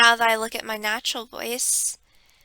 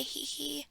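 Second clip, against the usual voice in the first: a light, high little "he, he" giggle, softer, in her mother's voice; imitating mother's giggle; 4 semitones higher, 13 dB quieter than usual. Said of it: "she actually did say".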